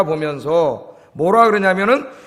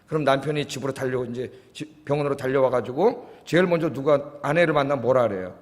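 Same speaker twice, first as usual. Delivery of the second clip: normal talking voice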